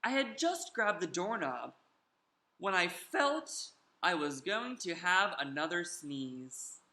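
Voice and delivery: ordinary delivery, nothing marked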